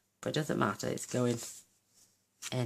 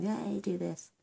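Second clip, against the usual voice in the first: mock viewer cheer; 8 semitones higher, slower than usual. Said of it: "You'll all be screaming at me now going".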